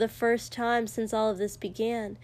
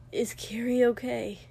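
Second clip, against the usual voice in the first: usual speaking voice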